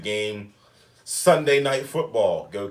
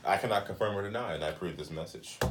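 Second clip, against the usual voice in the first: political ad disclaimer; 7 semitones lower, 10 dB quieter, faster than usual. Pretend.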